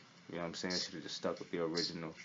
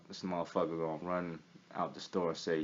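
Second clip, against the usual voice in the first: usual speaking voice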